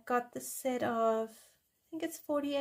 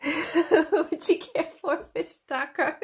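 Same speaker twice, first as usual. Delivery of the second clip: giggling, louder and higher, barely holding it together; laughing through it; 5 semitones higher, 7 dB louder than usual. Real, not acted. Storyteller offering a fish taco, laughing. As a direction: crack up mid-line.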